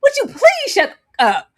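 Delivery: high, loud exasperated outburst; loud, revolted pleading; screamed disgust. Retold or imitated. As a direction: re-enact yelling at someone to stop. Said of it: "I actively screamed".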